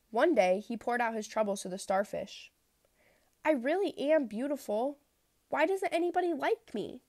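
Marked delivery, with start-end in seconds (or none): 3.5-7.0 high, pleading complaint; plaintive. Acted. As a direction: sound lost and lonely. Lonely fish pouring out troubles.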